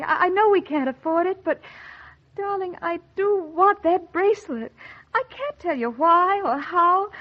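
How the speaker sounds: high pleading. Acted. Wife begs husband over the bracelet, high coaxing plea. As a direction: coax him, voice lifted.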